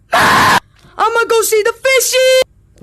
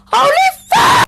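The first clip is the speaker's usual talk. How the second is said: startled higher yelp; higher shocked yell; 8 semitones higher than usual. Real, not acted. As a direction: shout it in shock. Shocked at urn.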